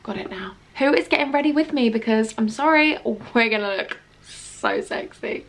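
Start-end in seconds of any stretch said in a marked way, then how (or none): 0.0-0.5 quiet, trailing self-talk; muttering to herself. Retold or imitated. Herself squinting at a product, muttering quietly. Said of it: "I always have to be like". 2.5-5.4 sultry tease. Acted. Promising viewers a sexy look, slow sultry purr. Getting ready, teasing viewers.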